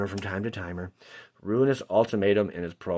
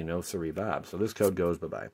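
normal talking voice

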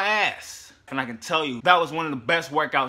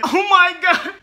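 high, loud whiny wail; complaining lifter; 12 semitones higher, 8 dB louder, slower than usual. Retold or imitated. Aching lifter whining loudly about his elbow. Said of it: "you're saying".

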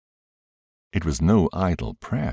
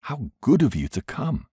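joyous; 9 semitones higher than usual. A narrator's reading. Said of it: "I cried out joyously".

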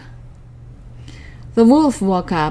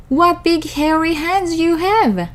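put-on higher innocent child voice; little girl voice; 9 semitones higher than usual. A narrator's reading.

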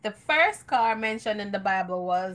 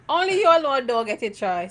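loud teasing; 4 semitones higher, 10 dB louder than usual. Real, not acted. Teasing call-out.

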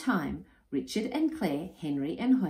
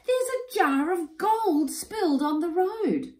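high-pitched, amazed announcement; excited cry; 13 semitones higher, 4 dB louder than usual. A narrator's reading. Boy tells his friends about spilled gold, high excited cry. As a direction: call out the find in high excitement. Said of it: "he cried".

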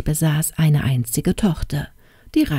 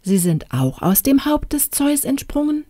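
much higher, cheeky princess; pert question; 9 semitones higher than usual. Acted. Princess needling the fairy with a cheeky question. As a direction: ask innocently mocking.

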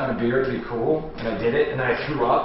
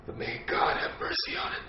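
whispering; 2 semitones lower, 10 dB quieter than usual. Acted. Solemn parting curse at interrogator.

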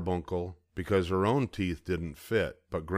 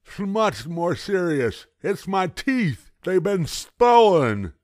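put-on high, slow, loud gummy old man; distressed toothless grandpa; 10 semitones higher, 8 dB louder, slower than usual. A narrator's reading. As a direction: mumble it like a grandpa without teeth.

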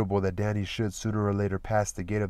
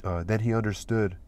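plain reading voice

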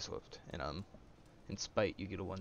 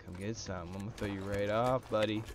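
normal talking voice

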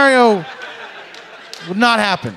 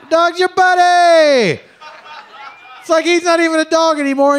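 mocking; 11 semitones higher, 4 dB louder than usual. Mocking owner's friendship.

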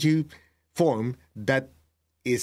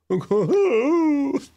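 high squealing laugh; real laugh, high and breaking; 13 semitones higher, 4 dB louder than usual. Real, not acted. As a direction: burst into a high, crying laugh. Laughing after teacher's remark.

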